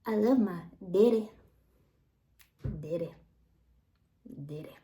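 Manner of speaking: put-on little kid saying daddy with a Southern accent, fading off; Southern child accent. Former kid repeats his Southern "daddy" to viewers. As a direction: imitate a small boy's Southern twang, trailing off. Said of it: "that would love to say".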